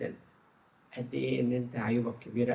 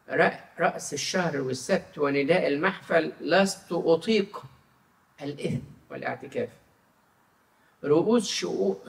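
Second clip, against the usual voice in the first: slow, louder and raised reading of God's words; solemn scripture reading; 5 semitones higher, 6 dB louder than usual. Acted. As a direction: proclaim the verse slowly, weightily. Reads Isaiah aloud in sermon. (قراءة كتابية مهيبة ببطء)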